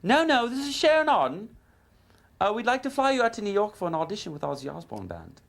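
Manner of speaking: very high imitated female caller; Sharon Auden. Manager inviting guitarist to audition, high imitated female voice. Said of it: "woken up by this female voice".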